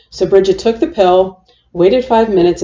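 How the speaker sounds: plain reading voice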